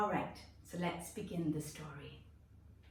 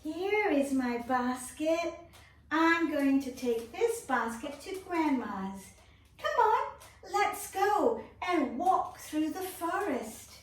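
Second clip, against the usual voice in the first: put-on higher, cheerful little girl; 9 semitones higher, 5 dB louder than usual. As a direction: play the girl for the kids. Continues in role.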